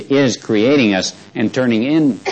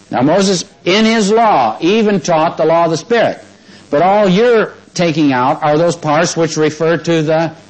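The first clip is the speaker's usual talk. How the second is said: fervent preaching; 6 semitones higher than usual. Acted. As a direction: preach it with full conviction. Preaching with rising fervor.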